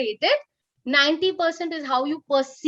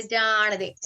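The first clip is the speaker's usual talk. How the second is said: dismissive let it go; 4 semitones lower than usual. Shown as a demonstration. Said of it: "put your right hand, flick it, and say".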